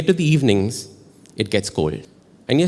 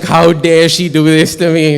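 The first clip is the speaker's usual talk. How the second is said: indignant, higher and louder, slowed for emphasis; mock outrage; 4 semitones higher, 7 dB louder than usual. Sore loser fuming about the girl beside him, mock outrage. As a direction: play up the wounded indignation. Acting out his outrage at losing.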